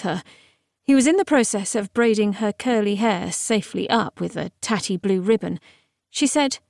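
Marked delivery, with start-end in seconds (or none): none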